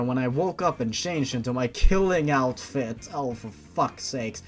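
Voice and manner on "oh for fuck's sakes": ordinary talk, nothing marked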